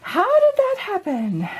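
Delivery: high, slow and amazed. Real, not acted. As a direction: marvel at it, drawn out. Surprise at the result.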